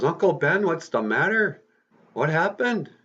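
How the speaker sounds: high-pitched acted alarm; urgent questions, voice jumping up high. Peter finds his uncle shot.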